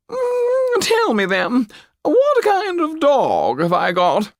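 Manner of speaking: a frivolous court lady teasing the doll; painted society lady. Acted. A lady with a painted fan asking the Pierrot a question, high and coy.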